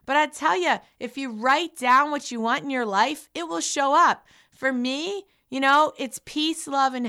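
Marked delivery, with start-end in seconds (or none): none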